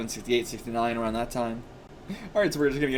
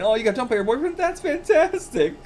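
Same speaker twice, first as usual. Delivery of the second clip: mock gloat; 8 semitones higher than usual.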